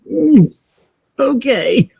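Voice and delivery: high, hesitant mock agreement; uneasy, stalling reply. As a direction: hum high, then a wary okay. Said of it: "and I'm kind of hesitant".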